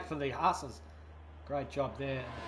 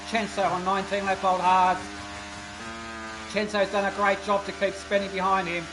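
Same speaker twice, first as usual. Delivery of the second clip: live race commentary; 4 semitones higher, 4 dB louder than usual. Acted.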